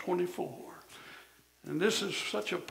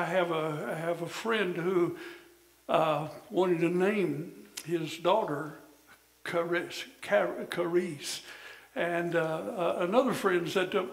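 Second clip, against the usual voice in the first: usual speaking voice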